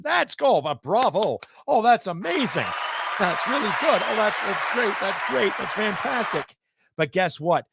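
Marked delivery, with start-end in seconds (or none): 0.0-6.5 high, mocking fake enthusiasm; sarcastic praise. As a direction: gush over it like you mean the opposite. Sarcastic praise of inflation news.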